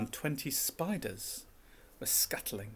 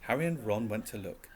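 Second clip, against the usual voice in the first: plain reading voice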